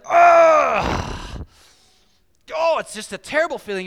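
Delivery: loud high groan of dread; mock anguished groan. Driver caught by police, loud mock groan. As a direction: ham up the dread. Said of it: "'Cause you're like".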